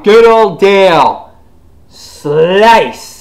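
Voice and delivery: loud, high, hammed-up exclamation; gleeful action call. Fan retells Dale's axe blow, loud hammed-up cry. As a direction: holler it like an action highlight.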